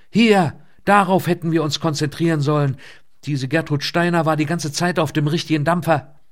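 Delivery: loud, high, urgent exclamation; agitated outburst. A narrator's reading. Archivist showing Elke the printout, loudly agitated. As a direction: burst out loudly, pitched well up.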